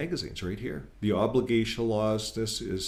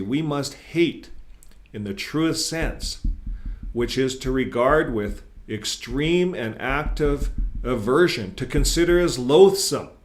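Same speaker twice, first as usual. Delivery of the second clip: mock outrage building; 5 semitones higher, 7 dB louder, slower than usual. Reading hateful Watchtower quote aloud.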